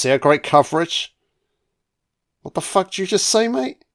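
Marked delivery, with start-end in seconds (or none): 2.4-3.8 quick, high, rising incredulous question; mock challenge. Vlogger voicing an affronted film character, mock challenge. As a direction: pitch climbs all the way to "mate".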